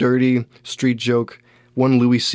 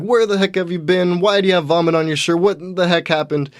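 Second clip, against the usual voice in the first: higher, mildly cross wife demanding answers; a hammed-up scolding wife, pitched up; mock angry wife; 7 semitones higher than usual. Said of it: "She's pissed off".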